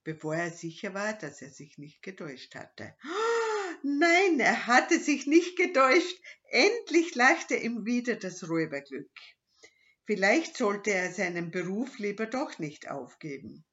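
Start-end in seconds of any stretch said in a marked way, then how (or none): 3.0-3.8 high thrilled gasp; sharp high gasp of joy; excited gasp. Acted. Robber realises it's gold. 3.8-9.1 loud, high, jubilant narration; excited narration. A narrator's reading. Narrator shares robber's joy.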